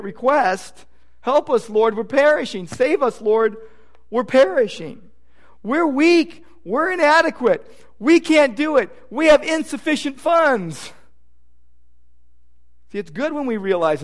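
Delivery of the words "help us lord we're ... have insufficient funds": higher pleading cry for help, voicing the disciples; desperate plea. Preacher voicing frightened disciples crying to the Lord, pleading. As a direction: beg the Lord to save you, a touch panicked.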